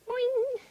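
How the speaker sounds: high springy "boing"; ringing high jump noise. A narrator's reading. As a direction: voice a cartoon bounce. Imitated noise of jumping down.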